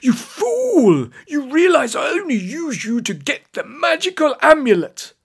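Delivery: high, loud villain's chortling gloat. A narrator's reading. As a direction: crow in triumph at the foolish victim.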